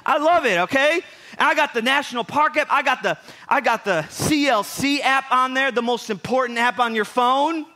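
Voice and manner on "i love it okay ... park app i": excited. Real, not acted. Defends his nerdy apps, laughing.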